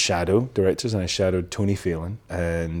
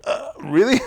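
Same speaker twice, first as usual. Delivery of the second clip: surprised disbelief; 11 semitones higher than usual. Retold or imitated. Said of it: "And I was like".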